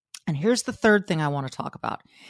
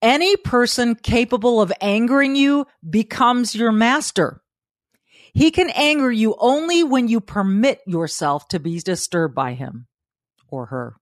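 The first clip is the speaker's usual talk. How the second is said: higher, louder, declaiming quote; quoting Epictetus; 5 semitones higher, 4 dB louder than usual. Acted. Host reading Epictetus aloud, lifted declaiming voice. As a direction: read it like a proclamation. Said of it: "my Stoic friends, Epictetus".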